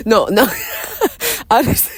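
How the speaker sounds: breaking into real laughter; can't stop laughing mid-sentence. Real, not acted. Bursts out laughing at herself.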